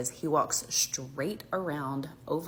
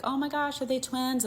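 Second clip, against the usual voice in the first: put-on high gushing old-timer, much higher pitch; gushing grandparent; 10 semitones higher than usual. Grandparents at picnic tables fuss over the mom's kids, high and gushing.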